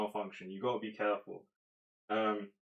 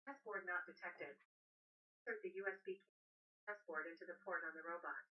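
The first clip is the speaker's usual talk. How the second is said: robot voice; 7 semitones higher, 17 dB quieter than usual. Real, not acted. Robot device speaks prompt.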